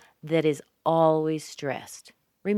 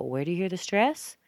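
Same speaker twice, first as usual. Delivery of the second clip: ordinary delivery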